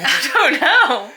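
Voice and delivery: real laughter breaking in; laughing. Real, not acted. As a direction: laugh through the words. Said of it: "Why have you gone Cockney?".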